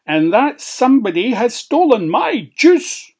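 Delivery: loud, high howl of outrage; aggrieved dwarf howling; howled complaint. Acted. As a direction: howl about being robbed. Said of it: "howled Crabbit".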